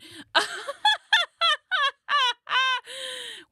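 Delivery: high, loud witchy cackle; long loud cackle after the joke; loud cackling laugh. Real, not acted.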